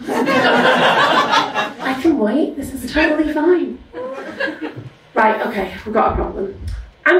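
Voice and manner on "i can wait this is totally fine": high, drawn-out sing-song I can wait; mock patience. Real, not acted. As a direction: fake calm through laughter, stretched out slow. Exaggerated fake patience, laughing.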